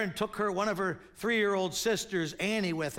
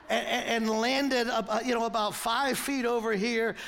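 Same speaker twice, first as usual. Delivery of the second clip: normal talking voice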